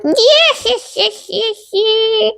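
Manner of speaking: high, shrill-free mock witch cries of yes; hollering witch. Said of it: "She'd be hollering like".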